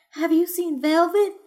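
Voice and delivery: high, anxious woman's voice; pleading for news of her cat; cat owner begging the caller for news; worried cat owner. Acted.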